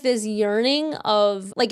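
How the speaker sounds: normal talking voice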